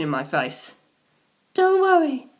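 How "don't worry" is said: put-on much higher young woman's voice, gentle; Sugimoto's voice. A narrator's reading.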